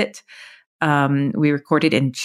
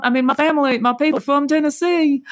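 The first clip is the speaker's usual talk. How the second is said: Tennessee accent; 12 semitones higher than usual. Shown as a demonstration. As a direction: talk high in a broad Tennessee accent, stretching the last words. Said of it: "has a form of a Tennessee accent".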